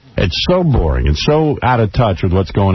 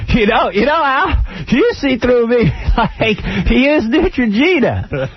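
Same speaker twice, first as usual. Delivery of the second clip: mocking impression; 13 semitones higher, slower than usual. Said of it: "Shut up, come here".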